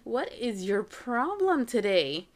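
slow, stretched-out exasperated question; retold motherly reproach; exasperated confrontation. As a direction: demand it word by word, acting fed up. Mother confronts daughter again.

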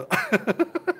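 high laugh fading out; stifle a fit of giggles; stifled laugh. Real, not acted. (rire étouffé)

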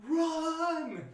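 high, long drawn-out shout; urgent yell, retold. Eric to his friend under attack, retold urgent yell. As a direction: yell it as an all-out alarm.

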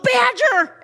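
sudden high, loud yelled name; mock yell. Parent yelling at freaking-out kids, high and loud. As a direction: bark the nickname at squabbling kids, way up high. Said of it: "and I just yelled".

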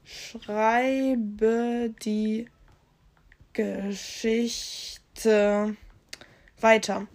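very slow, drawn-out words with long gaps; speaking while typing. Real, not acted. User typing a prompt to continue the story, speaking along slowly. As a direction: say each word as you type it. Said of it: "ich schreibe jetzt mal".

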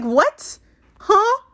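mock-startled, pitch leaping up on "huh"; high, punchy double take; baffled disbelief. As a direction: play a stunned, incredulous comeback. Acting her baffled reply to confession.

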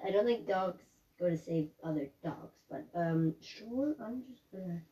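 mumbled aside. Pretend. Talking to hot dog vendor.

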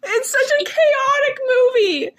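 can barely talk for laughing; real helpless laughter; laughing hard. Real, not acted. Cracking up about the movie.

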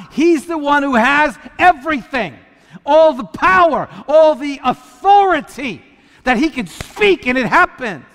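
high, loud declaration of power; full-force preaching. Real, not acted. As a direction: proclaim it to the whole congregation. Preacher builds to climax on God's power.

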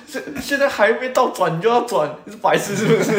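high, laughing mock scold; mock angry scolding. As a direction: imitate a harsh coach half-laughing. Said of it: "他口气是真的很凶的".

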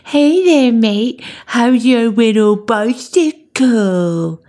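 put-on loud, high taunting baby talk, slowing on the last words; mocking babyish voice. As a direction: jeer at him like he's a toddler. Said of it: "in a mocking, babyish voice".

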